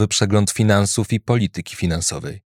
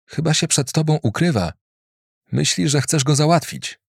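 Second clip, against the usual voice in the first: Marlon's voice; 6 semitones higher than usual. A narrator's reading. Marlon answers.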